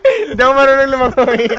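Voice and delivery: laughing and crying out at once; nervous laughing. Real, not acted. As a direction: laugh through real fear. Laughing in fright on raft.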